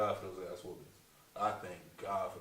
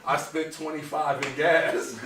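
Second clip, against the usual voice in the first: slow, laughing through it, higher and louder; 7 semitones higher, 22 dB louder, slower than usual. Real, not acted. Comedian laughing while saying the gas cost half his pay. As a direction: laugh out the punchline.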